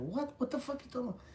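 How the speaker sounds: high-pitched incredulous demand; squawked "what the fuck"; retold disbelief. Retold confused reply.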